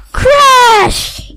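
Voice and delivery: loud high crash exclamation. A narrator's reading. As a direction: belt out the crash. Sound effect in story.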